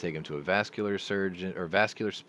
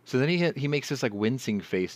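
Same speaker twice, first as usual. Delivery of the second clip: ordinary talk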